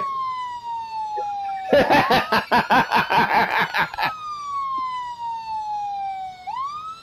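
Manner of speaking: long squealing laugh; high-pitched cackle. Real, not acted.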